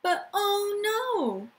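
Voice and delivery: high, slow, exaggerated alarm; dramatic oh no. Teacher hits a story twist for the children, mock alarm. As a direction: gasp it out for the kids like danger just appeared.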